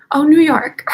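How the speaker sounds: surprised. Real, not acted. Sudden remembering.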